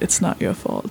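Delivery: put-on soft, low American-accented quote; hushed American accent. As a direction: whisper it like the film's American therapist. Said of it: "I shouldn't have said it in an American accent".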